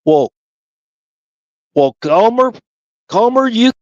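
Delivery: a hint of a flustered drill sergeant stammering; lightly voiced sergeant caught without an answer; mild sputtering sergeant; Sergeant Carter. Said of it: "I don't have Sergeant Carter down".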